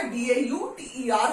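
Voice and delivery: डीएयूटीईआर spelled letter by letter. Shown as a demonstration. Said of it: "हम pronounce कर रहे हैं". (अक्षर-अक्षर बोलना)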